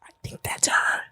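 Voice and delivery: hushed aside, acting out a friend; discreet whisper. Friend quietly pointing out a woman in the lobby, discreet whisper. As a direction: whisper it like a secret tip.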